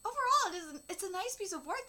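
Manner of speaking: ordinary delivery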